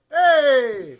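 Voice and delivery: loud shouted greeting; shouted hey. Real, not acted. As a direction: call out hey to open. Shouted show opener.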